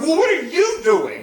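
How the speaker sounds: high, loud indignant comeback on YOU; mad retort. Retold or imitated. Busted teenager answering the nurse back, mad. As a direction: throw the question back, leaning on "you". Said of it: "it makes you so mad".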